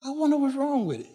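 high, quiet, fast puzzled voice; puzzled car owner. Retold or imitated. Stranded driver wondering why his car died. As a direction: squeak out the confusion in a thin high voice. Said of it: "You say".

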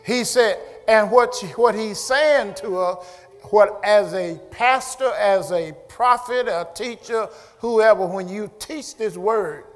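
full-voiced, emphatic preaching. Real, not acted. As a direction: press the point on the congregation.

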